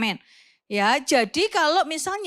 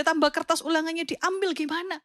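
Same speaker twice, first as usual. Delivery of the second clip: normal talking voice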